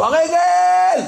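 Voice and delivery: long, very high drawn-out cry of pain; pained shout. Retold or imitated. A patient yells for his leg in a drawn-out high shout. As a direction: stretch it slowly into a desperate yell.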